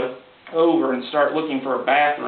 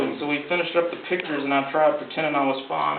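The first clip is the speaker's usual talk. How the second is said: usual speaking voice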